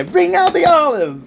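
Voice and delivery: high, loud mimicked yell; hammed-up shout; mock announcer shout. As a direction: bellow it like a dramatic TV reveal. Mimicking the shout from played clip.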